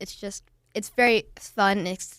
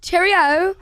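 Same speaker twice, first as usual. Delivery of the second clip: loud, high-pitched British accent; 9 semitones higher, 8 dB louder than usual. Shown as a demonstration. Kid showing off for the host, loud British accent. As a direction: project a big cheery British voice. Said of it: "some of your best British accents".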